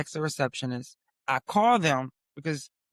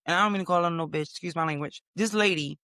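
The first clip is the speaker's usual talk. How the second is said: ordinary delivery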